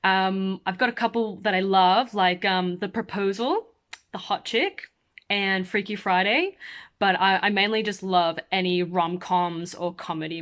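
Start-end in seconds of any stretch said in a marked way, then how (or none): none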